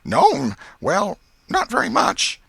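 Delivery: put-on a nervous boy hedging his answer; Tom. A narrator's reading. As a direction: nephew evading his aunt's trap, nervous. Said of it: "So he said".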